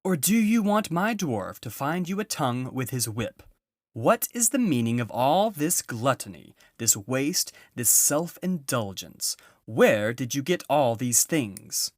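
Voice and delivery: high, loud scolding interrogation; angry witch queen. A narrator's reading. Witch queen berating the party over the feast, loud angry scolding. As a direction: scold them like a furious queen. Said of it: "witch queen questions the animals".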